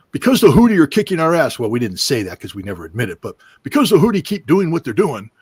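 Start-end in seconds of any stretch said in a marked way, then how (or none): 0.1-1.6 mock official. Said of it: "come out and say". 3.6-5.3 higher, louder mock blustering threat; mock tough threat. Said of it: "president and Pete Hegseth come out and say".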